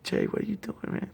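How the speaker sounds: high, puzzled question; imitating his own baffled self; amused surprise. Himself asking friend, acted.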